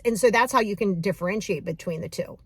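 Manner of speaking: normal talking voice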